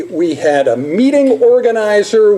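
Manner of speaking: ordinary talk, nothing marked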